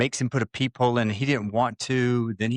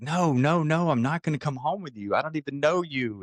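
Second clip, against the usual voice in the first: higher, emphatic protest; insistent refusal, pitched up; retold protesting refusal; 6 semitones higher than usual. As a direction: act out fending off a plea. Acted refusal in retold argument.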